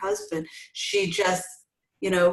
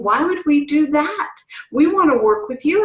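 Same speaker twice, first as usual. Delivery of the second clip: pitched up, louder pushback; mock protesting families; 9 semitones higher, 4 dB louder than usual. Families arguing with the coach against Al-Anon, mock protest. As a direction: play the reluctant families. Families pushing back, retold.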